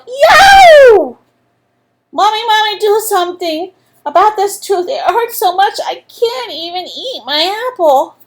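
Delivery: put-on high, loud yelling child in pain; yelling boy. A narrator's reading. Andrew yelling to his mom about his tooth, loud and high. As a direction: shout it like a kid with a sore tooth. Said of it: "And he yelled".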